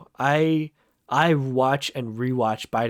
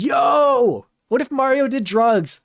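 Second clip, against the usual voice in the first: high, loud hyped-up yell; excited shout; 12 semitones higher, 9 dB louder, slower than usual. Real, not acted. Hyped reaction to video idea.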